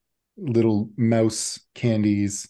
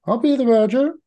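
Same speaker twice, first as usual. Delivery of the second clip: put-on much higher, loud little kid voice; eager child; 7 dB louder, faster than usual. Jammies the child volunteers to the host, high and eager. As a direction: play an eager kid who shoots his hand up.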